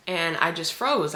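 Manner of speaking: ordinary delivery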